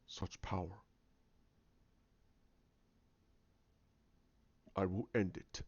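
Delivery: low, soft dark-side villain; hushed, deep villain voice; menacing villain. Acted. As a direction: speak low and quietly, with menace. Villain stops and speaks menacingly.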